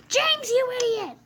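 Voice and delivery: high, loud furious yell at James; shrill engine scolding James, loud and angry. Acted. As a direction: scream the insult.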